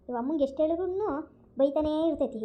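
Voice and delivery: usual speaking voice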